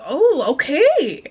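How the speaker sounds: drawn-out, swooping "oh, okay"; mock okay. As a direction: stretch it out with exaggerated pitch swoops. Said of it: "that's how I did".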